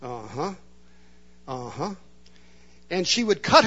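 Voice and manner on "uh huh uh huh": low, much softer flat uh-huhs; mumble. Retold or imitated. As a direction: mumble it, unimpressed. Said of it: "and mumble".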